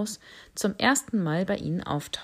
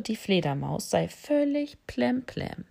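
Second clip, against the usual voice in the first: usual speaking voice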